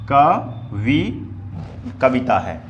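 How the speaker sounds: Kavita stretched syllable by syllable; slowed sounding out. Shown as a demonstration. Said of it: "धीरे से बोल रहे हैं". (कविता को धीरे-धीरे खींचकर बोलें)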